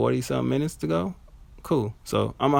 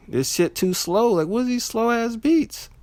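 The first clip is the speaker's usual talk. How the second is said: high-pitched, whiny disbelief; exasperated complaint; 12 semitones higher than usual. Retold or imitated. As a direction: complain as if you can't take it anymore. Acting out complaint about slow beats.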